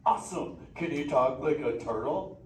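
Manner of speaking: a hyped, easygoing beach dude of a turtle; Crush the sea turtle. Acted. Sea turtle greeting the kids in the theater, stoked surfer-dude voice.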